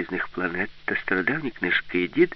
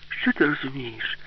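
put-on higher, slower girl character's line; lightly voiced Alice, raised pitch; 6 semitones higher than usual. A narrator's reading. Alice retorting to the household robot, higher character voice.

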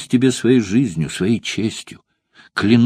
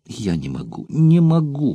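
despairing cry; 6 semitones higher than usual. A narrator's reading. Spartacus cries out to Valeria in despair. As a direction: break from a murmur into a desperate shout. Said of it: "прокричал он в отчаянии".

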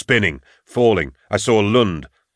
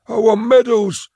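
much higher, a sudden scream; 4 dB louder than usual. Acted. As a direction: snaps and shrieks it out of nowhere. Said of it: "screamed suddenly".

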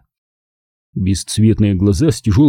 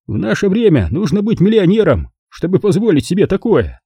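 weary racer; 8 semitones higher than usual. A narrator's reading. Courtney's character voice continues.

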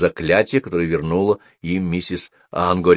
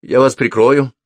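higher, louder, nervous promise; trembling voice; 5 semitones higher, 6 dB louder than usual. A narrator's reading. Frightened apprentice promises to cover Sophie, trembling voice. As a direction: promise protection with a touch of tremble. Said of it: "дрожащим голосом проговорил он".

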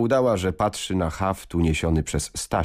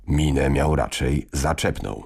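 usual speaking voice

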